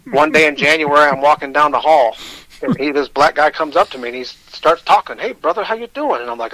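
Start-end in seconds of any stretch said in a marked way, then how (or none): 5.2-6.2 a lisping cartoon-cat greeting, pitched higher; a light take on a cartoon cat; Sylvester the Cat voice. Rick greets him in the prison hallway, lightly imitated cartoon cat. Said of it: "He kinda talked like Sylvester the Cat".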